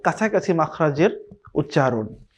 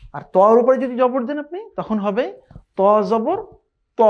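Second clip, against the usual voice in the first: ordinary talk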